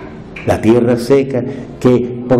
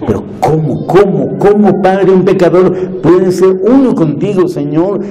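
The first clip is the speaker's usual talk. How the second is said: rising, louder and higher pleading cómo, cómo; anguished question; 8 semitones higher, 4 dB louder than usual. Retold or imitated. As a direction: cry out the question to the Father in disbelief. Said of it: "Era mi pregunta".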